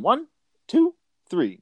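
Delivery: high-pitched mock count. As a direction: squeak the count like a cartoon villain. Counting before firing, quoted.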